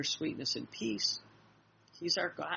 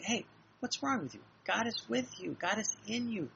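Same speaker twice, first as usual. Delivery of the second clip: higher-pitched pep talk to oneself; self-admonishing; 7 semitones higher than usual. Acted. Talking to ourselves when worried and complaining, mild admonishing. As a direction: talk yourself out of worry, pitch lifted. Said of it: "that's how we have to talk to ourselves".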